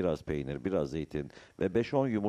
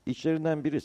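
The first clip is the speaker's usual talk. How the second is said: ordinary delivery, nothing marked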